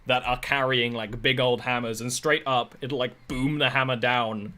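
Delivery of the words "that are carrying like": usual speaking voice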